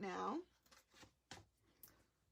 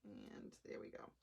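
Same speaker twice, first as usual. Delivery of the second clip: breathy whisper, almost to herself; whispered aside; 14 dB quieter than usual. Real, not acted. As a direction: whisper "And there we go" softly under the breath. Finishing step, muttered to herself.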